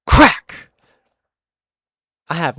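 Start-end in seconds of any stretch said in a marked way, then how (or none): 0.0-0.7 loud sharp crack sound; mock impact crack; imitated crack. Shown as a demonstration. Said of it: "It went".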